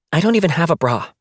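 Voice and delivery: fast, rushed blurt; quickly. Acted. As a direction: hurry it out to change the subject. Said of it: "Bird said quickly".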